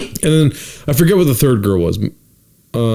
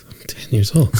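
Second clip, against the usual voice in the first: put-on whispered child's reply; hushed kid answer; 4 dB quieter than usual. Boy answering the magician on stage, hushed.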